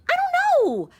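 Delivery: high, helpless I don't know; flustered. Acted. Suspect pleading ignorance to the detective, high and flustered. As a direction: throw it up high, at a loss.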